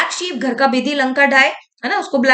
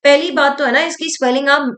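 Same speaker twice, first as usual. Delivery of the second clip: normal talking voice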